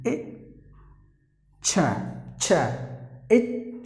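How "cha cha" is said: "cha, cha" with a clear ch sound. Shown as a demonstration. Teacher models "cha" for students. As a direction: say "cha" crisply, twice. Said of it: "is pronounced like cha".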